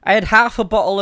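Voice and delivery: loud, high tipsy boast; tipsy and loud, a touch slurred. Real, not acted. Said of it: "I'm pissed out my head".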